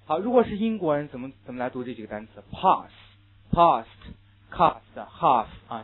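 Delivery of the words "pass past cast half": British "Pass", "past", "cast", "half" with a broad open ah vowel; teacher modelling the British vowel; British pronunciation. Shown as a demonstration. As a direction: spell out the British ah in each word. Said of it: "非常典型的英国人的读法".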